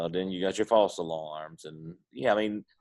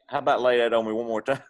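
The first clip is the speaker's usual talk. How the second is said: Southern phrase; 4 semitones higher, 5 dB louder, faster than usual. Retold or imitated. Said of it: "You know, like".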